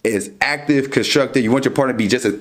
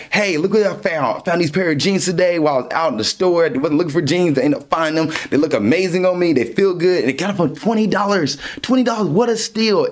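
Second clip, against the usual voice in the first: bright, higher, fast-tumbling excitement; excited sharing; 4 semitones higher than usual. Acted. Showing off new jeans to a partner, eager and thrilled. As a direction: gush about the bargain like a thrilled shopper.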